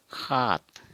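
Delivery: rasped kh, then long aat in खात; Assamese kh pronunciation. Shown as a demonstration. Teacher showing how Assamese says seven, kh for s. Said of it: "ख का या ह का उच्चारण".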